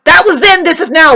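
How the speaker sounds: high, loud parroted retort; mocking the neighbours. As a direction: throw their excuse back, loudly mimicked. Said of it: "then they say".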